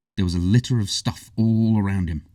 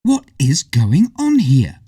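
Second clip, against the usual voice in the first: put-on high, slow girl's voice; slow, puzzled young heroine; Hazel's voice; 7 semitones higher, 7 dB louder, slower than usual. A narrator's reading.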